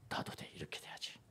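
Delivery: hushed whisper, half to himself; whispered thought. Retold or imitated. Young student vowing to himself, whispered. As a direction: breathe it out like a private vow. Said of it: "이렇게 하는 거야".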